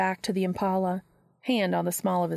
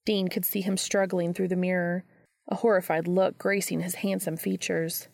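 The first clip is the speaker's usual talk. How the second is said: usual speaking voice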